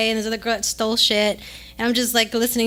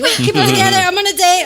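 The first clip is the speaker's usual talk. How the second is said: higher, louder self-talk, forcing calm; reining in anger; 6 semitones higher, 6 dB louder than usual. Retold or imitated. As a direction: mad but trying to hold it together. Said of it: "I was so mad".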